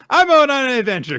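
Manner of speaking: put-on high, loud, fast little-kid voice; Anya. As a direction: shout it like an excited child at play. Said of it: "so Anya is like".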